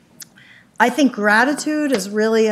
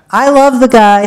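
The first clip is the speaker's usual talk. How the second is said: louder, higher, stress on love; emphatic exclamation; 4 semitones higher, 13 dB louder than usual. Real, not acted. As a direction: declare it with a burst of feeling. Repeating affectionate claim about boss.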